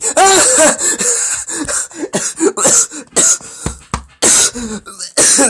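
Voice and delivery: long, high, loud cackle; hammed-up wicked cackling; wicked laugh. Reader acting out Sharon's elated laughter, long wicked cackle. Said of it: "laughing wickedly".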